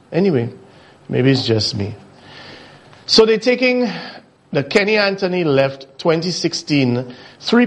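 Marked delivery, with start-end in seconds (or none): none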